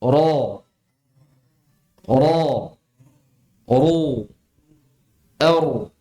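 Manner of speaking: thick heavy R in "Ro", "Ru", "Er", said singly. Shown as a demonstration. Teacher drills students on "Ro", "Ru", "Er", thick R. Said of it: "Pangkal lidah".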